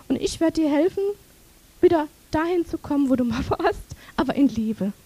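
ordinary talk

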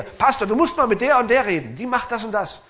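high, loud urgent plea to the pastor; a member nagging the pastor, loud and high-pitched; complaining church member. Retold or imitated. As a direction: press the pastor to step in, loudly.

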